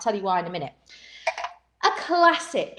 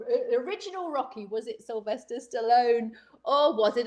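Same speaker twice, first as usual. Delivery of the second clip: normal talking voice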